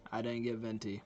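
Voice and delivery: ordinary talk, nothing marked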